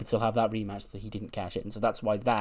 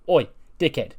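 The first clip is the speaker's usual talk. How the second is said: loud, higher insulting holler; rude call-out; 5 semitones higher, 6 dB louder than usual. Acted. Wrestler accosting his boss as he leaves, rude call-out. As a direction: call out to pick a fight.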